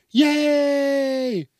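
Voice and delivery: high cheerful shout; cheering crowd. Retold or imitated. Comedian playing passengers cheering a high-fiving kid, high shout.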